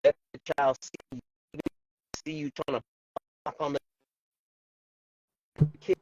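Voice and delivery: choppy cutting-out audio; breaking up. Real, not acted. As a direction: signal dropping in and out. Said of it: "breaking up".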